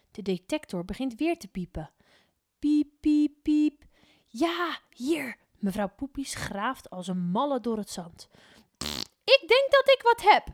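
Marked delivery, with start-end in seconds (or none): 2.6-5.4 high, loud beeping; beeping detector. A narrator's reading. The detector beeping over the sand, high and loud. 9.2-10.5 very high and loud; thrilled she found something; excited. A narrator's reading. Mevrouw Poepies digging, very high excited voice.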